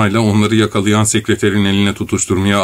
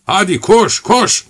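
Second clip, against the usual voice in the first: urgent; 10 semitones higher, slower than usual. A narrator's reading. Urging Korotkov to run.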